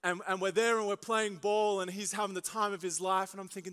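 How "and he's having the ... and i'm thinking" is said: normal talking voice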